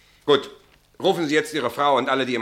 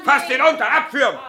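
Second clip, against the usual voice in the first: loud and high, a curt command; shouted order; 12 semitones higher, 4 dB louder than usual. Acted. Captain ordering his men to take him away, shouted. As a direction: bark it at the soldiers.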